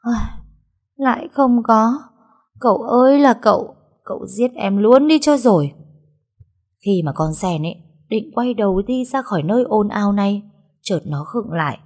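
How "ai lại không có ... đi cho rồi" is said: high, loud despairing moan after a sigh; sighing, theatrically fed up; exasperated sigh. Acted. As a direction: wail at her hopeless master in mock despair. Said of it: "thở dài".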